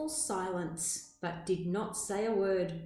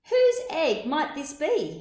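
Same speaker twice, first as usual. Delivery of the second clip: higher and louder, calling to the neighbours; 7 semitones higher, 8 dB louder than usual. Acted. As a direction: call out across the desert to find the owner. Hunwig calls on neighbours.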